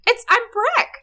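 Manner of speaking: high put-on son voice; lightly voiced awkward son; mock Breck. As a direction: squeak it out like a nervous kid. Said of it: "Greg's just like".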